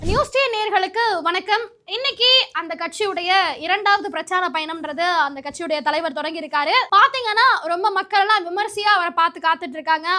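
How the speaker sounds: measured field reporter voice; news report. Acted. Reporter addressing viewers about the rally, news read.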